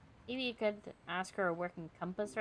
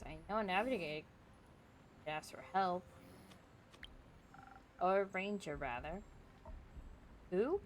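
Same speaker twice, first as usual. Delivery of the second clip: ordinary talk, nothing marked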